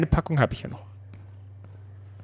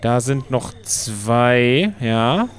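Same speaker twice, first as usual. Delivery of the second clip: normal talking voice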